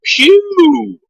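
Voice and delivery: exaggerated high-pitched phew; high mock phew. Dad fanning his own fart by the fire, high mock phew. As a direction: squeal it out like smelling a fart.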